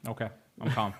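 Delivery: calmed down. Pretend. Co-host told to calm down, answering low and calm. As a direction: drop to a quiet, obedient reply. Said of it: "Calm, hey, calm down".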